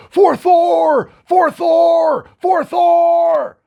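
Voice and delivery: full-throated barbarian war cry, high and loud; screaming. Acted. Barbarian rallying at the forge for his god, screaming. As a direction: scream it out with long held notes. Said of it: "Korgoth is gonna start screaming".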